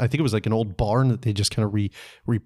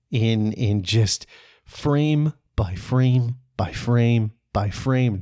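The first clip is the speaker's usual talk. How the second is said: very slow, spaced-out phrases; slow-motion narration; much slower than usual. Real, not acted. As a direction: draw it out like a frame-by-frame replay. Retelling the shot in slow motion.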